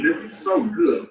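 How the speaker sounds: high, slow mock savoring of food; savoring daughter. Daughter eating beside fasting dad, slow high mock savor. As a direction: tease him with how good it tastes. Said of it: "my demon in there just grubbing in my face".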